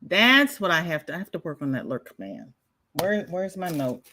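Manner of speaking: ordinary talk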